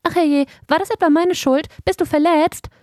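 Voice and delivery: high, dainty little-animal voice; tiny creature piping up high; delicate squeaky voice. A narrator's reading. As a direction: squeak it in worried concern. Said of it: "piepste eine zarte Stimme".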